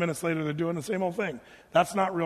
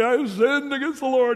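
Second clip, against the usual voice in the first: hammed-up tearful wail, higher and louder; a showy televangelist sob on "I have sinned"; mock weeping confession; 5 semitones higher, 4 dB louder than usual. What David didn't say to Nathan, in mock weeping. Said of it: "televangelist weeping on T V".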